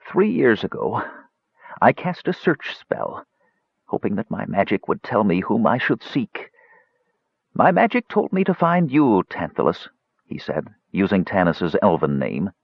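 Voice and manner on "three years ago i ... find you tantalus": high, effortful wizard recounting; ailing old mage. A narrator's reading. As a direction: tell it haltingly as the failing mage. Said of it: "gave a deep sigh and continued".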